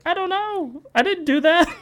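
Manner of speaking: put-on high squeaky kid's protest; high-pitched guilty child; whiny denial. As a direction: plead innocence in a little-kid squeak. Kid denying breaking shifter.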